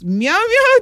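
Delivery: high, playful cat call; playful meow. Pretend. Playful cat-call sign-off to guest.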